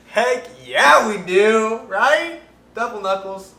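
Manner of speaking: loud, high, emphatic yes; hyped-up. Real, not acted. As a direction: proudly belt out the answer. Hyped answer to silly question.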